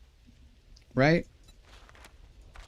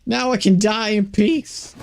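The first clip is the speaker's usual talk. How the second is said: much higher, giddy squeals of joy; giddy relief; 9 semitones higher than usual. Real, not acted. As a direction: cheer like you finally won. Game goal reached, cheering.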